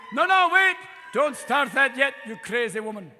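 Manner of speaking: playful protest at the crowd; mock alarmed call. Real, not acted. Stopping the audience laughing.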